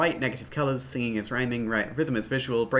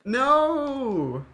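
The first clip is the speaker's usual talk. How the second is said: long high drawn-out cry; dismayed wail; 10 semitones higher than usual. Real, not acted. Player facing a broken game, dismayed wail.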